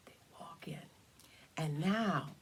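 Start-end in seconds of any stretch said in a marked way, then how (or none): 0.1-0.9 hushed whisper. A narrator's reading. Storyteller hushing children as dragons enter. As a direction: breathe it out secretly.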